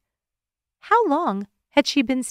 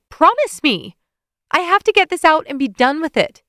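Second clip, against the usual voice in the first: higher, loud, urgent; insistent plea; 7 semitones higher, 6 dB louder than usual. A narrator's reading. Woman demands Evan's promise, higher and urgent. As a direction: demand his promise, desperate.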